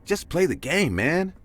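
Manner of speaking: high, irritated snap back; annoyed retort. Retold or imitated. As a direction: tell him off, fed up. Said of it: "I text back saying".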